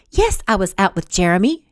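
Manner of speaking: ordinary delivery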